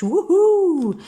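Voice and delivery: high, loud cheer of delight; overjoyed yell; excited whoop. Real, not acted. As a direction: whoop like you just got great news. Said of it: "Excusez-moi, un peu trop d'enthousiasme".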